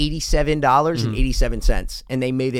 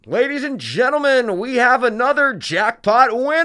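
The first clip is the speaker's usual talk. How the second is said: high, drawn-out casino announcement; imitated hype voice over the loudspeaker; loudspeaker announcer; 11 semitones higher, 4 dB louder than usual. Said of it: "you hear on the loudspeaker".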